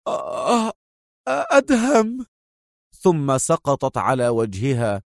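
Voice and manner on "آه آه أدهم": high, weak cries of agony; Mona in pain. A narrator's reading. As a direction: gasp out his name as if shot. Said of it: "فصرخت في ألم".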